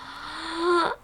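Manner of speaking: high, sharp intake of breath; sharp gasp. Retold or imitated. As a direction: gasp in sudden shock, acted out. Reacts to seeing the ring box.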